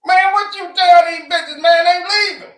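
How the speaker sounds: hammed-up father begging his famous son; pleading dad. Father begging [name] on the phone, high mock shout.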